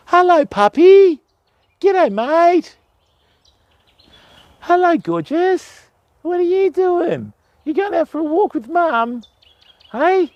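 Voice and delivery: high doting dog-talk voice. Real, not acted. Greeting passing dogs.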